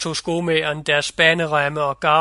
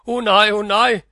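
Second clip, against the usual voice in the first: higher, louder pleading cry; råbende; 4 semitones higher, 5 dB louder than usual. A narrator's reading. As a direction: cry out in protest. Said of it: "råbte degnen". (råber bønfaldende)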